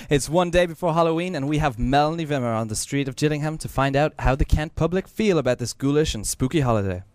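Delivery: usual speaking voice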